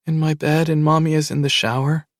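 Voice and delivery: put-on a scared girl hiding in bed; little girl. A narrator's reading. Scared child on phone.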